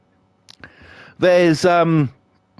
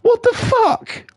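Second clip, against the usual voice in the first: incredulous; slower than usual. Real, not acted. Mocking the hard sell.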